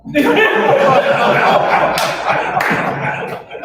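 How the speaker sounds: a big high laugh bursting out; hearty laugh. Real, not acted. Laughing at the boss's joke, loud high laugh.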